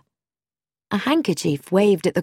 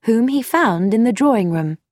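usual speaking voice

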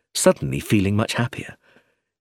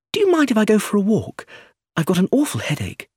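put-on lighter, higher young woman, quick; higher voice for Iris, asking to leave; young woman's voice; 11 semitones higher, faster than usual. A narrator's reading. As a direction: Iris asking to slip away.

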